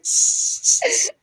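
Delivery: sharp mock hisses; imitated cat hiss. Host imagining Kayla clawing at Lucille, mock cat hisses. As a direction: spit out short cat hisses. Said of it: "She's gonna be like".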